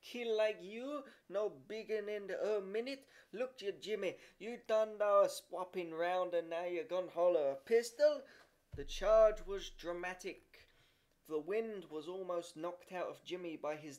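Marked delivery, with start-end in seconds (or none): 0.0-8.2 higher, scolding scorn; scandalised rebuke to a child; scornful horror. A narrator's reading. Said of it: "continued Peter in scorn and horror".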